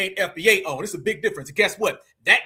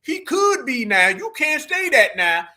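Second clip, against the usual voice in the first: slow, drawn-out higher-pitched protest; imitating a protester, slow and high; mock objector; 6 semitones higher, slower than usual. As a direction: draw out each word in mock objection. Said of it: "some Negro saying".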